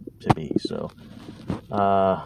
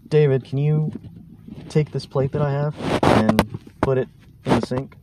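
higher, lightly voiced girlfriend; a hint of a nagging girlfriend; 6 semitones higher than usual. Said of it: "she goes".

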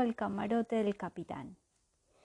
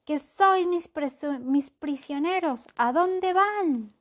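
indignant shouting; 6 semitones higher, 5 dB louder than usual. Acted. Captor shouts after lost prisoners.

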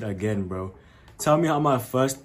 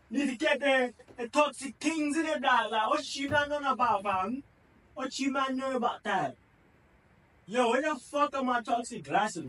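high London roadman voice; roadman accent; 11 semitones higher, 4 dB quieter than usual. Acted. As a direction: put on a toxic roadman voice. Toxic glasses London roadman voice.

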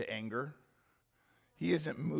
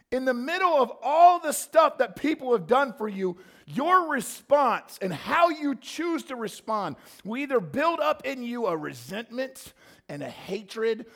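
raised, loud sermon push; full-force preaching; 8 semitones higher, 9 dB louder than usual. Acted. Pastor warns the church about bitterness, loud preaching. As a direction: drive the warning home to the congregation.

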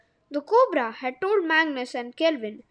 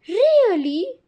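surprised; 7 semitones higher, 6 dB louder than usual. Acted. Magnus astonished.

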